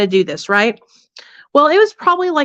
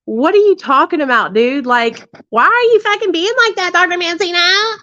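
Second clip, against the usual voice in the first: pitched way up, indignant retort; mock indignant; 8 semitones higher than usual. Retold snapping at the treatment doctor, high and indignant. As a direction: throw it back at him, offended and high. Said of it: "I was so mad".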